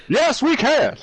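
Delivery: high rallying campaign chant; Obama impression voice. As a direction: punch the slogan, thumbs up. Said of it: "all the hand motions too".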